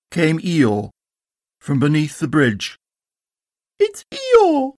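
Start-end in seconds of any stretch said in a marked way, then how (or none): 3.8-4.7 very high-pitched squeal of little Roo; terribly excited Roo. A narrator's reading. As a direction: cry it out as thrilled tiny Roo. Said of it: "terribly excited".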